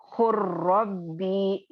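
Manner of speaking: "खुर्रब्बी" slow, with ल merged into a doubled र; recitation demo. Shown as a demonstration. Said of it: "ये इदगाम मुतकारिबैन का". (धीमी तिलावत, ल को र में मिलाकर)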